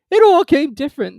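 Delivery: high, loud, musing turtle; thoughtful Mock Turtle. A narrator's reading. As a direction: turn it over in the mind. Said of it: "repeated thoughtfully".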